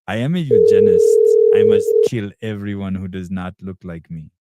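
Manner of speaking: mock villain voice. Reading a chat comment in a voice.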